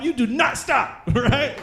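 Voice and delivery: normal talking voice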